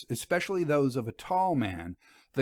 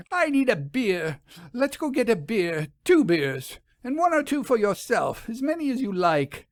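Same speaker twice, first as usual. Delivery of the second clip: much higher, flustered and rushed; 10 semitones higher, 3 dB louder than usual. A narrator's reading. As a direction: cover the upset with bluster. Vera flustered after sniffing.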